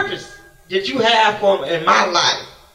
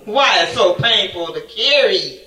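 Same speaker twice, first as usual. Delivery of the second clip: usual speaking voice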